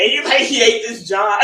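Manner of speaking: real giggles breaking into the words; laughing. Real, not acted. Telling how brother hated his job, laughing.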